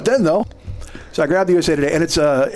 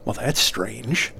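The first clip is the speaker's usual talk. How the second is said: slow, low, softer musing; quietly puzzled; 4 semitones lower, 6 dB quieter, much slower than usual. Retold or imitated. Foggy clerk finding his boombox moved, quietly puzzled. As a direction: puzzle it out slowly under your breath.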